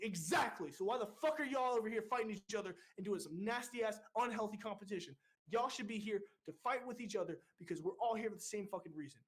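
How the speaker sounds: high, emphatic tirade; retold rousing scolding. Recruit leader uniting two rival divisions, forceful scolding. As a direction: re-enact driving the lesson home with force.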